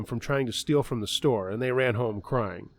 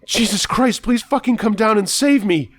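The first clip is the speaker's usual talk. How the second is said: very high, loud shrieking plea; frantic screaming prayer; 10 semitones higher, 8 dB louder than usual. Acted. Bloodied man screaming at heaven to be saved, frantic shriek.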